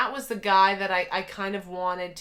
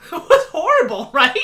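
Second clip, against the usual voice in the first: laughing; 9 semitones higher, 12 dB louder than usual. Real, not acted. Laughing about bad episode.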